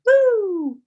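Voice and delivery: loud, high-pitched "woo" noise; long high woo, sliding down. Retold or imitated. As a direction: let out a high, eerie whoop. Imitated whoop of dancing imps.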